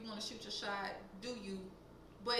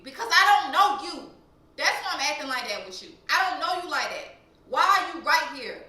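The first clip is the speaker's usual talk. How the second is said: loud, raised-pitch telling-off; angry confrontation; 4 semitones higher, 11 dB louder than usual. Retold or imitated. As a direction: re-enact snapping back at a stranger loudly. Re-enacts angry confrontation with stranger.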